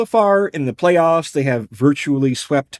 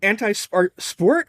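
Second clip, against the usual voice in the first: sport? pitched up high in mock disbelief; teasing stress on singular; 5 semitones higher, slower than usual. Shown as a demonstration. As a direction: lean on the bare word sport, teasing. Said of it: "sport singular instead of plural".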